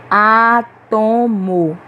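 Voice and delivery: "átomo" very slow, in three syllables, strong á; stress on antepenultimate syllable. Shown as a demonstration. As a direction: stretch out "átomo", leaning on the first syllable. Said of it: "que nós pronunciamos com mais força". ("átomo" bem devagar, silabado, com força em á)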